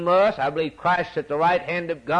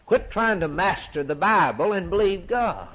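ordinary talk, nothing marked

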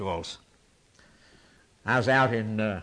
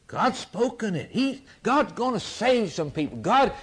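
full-force preaching; 9 semitones higher than usual. Acted. Preacher proclaiming God's glory to the congregation, full force. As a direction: preach it to the rafters.

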